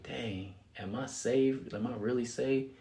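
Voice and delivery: quiet, uncertain inner voice; a boy staring at the ceiling, quietly doubting; hushed self-doubt. Retold or imitated. Lying awake, inner doubt.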